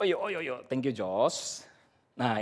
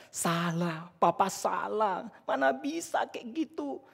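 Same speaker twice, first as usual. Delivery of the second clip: put-on nagging child; 5 semitones higher, 3 dB quieter, slower than usual. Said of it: "Dan dia terus merepet".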